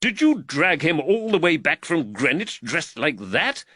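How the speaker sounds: exasperated, despairing question; note of desperation. A narrator's reading. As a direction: ask it as if despairing of your constable. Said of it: "note of desperation in the magistrate's voice".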